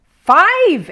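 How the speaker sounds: high, loud joyful cry; excited exclaim. A narrator's reading. Paddington cheering his own shot, loud excited cry. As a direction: shout it out in triumph. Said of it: "exclaimed Paddington".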